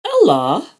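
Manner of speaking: high loud questioning call; mother calling. A narrator's reading. Mother calls out.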